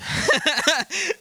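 high burst of laughter; amused laugh. Real, not acted. Preacher tickled by his chameleon picture, amused laugh. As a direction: laugh out loud at your own picture.

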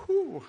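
high, sharp "whew" of breath; real emotional sigh of composure; teary exhale. Real, not acted. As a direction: blow out a breath to hold back tears. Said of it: "Sorry, I'm getting teary".

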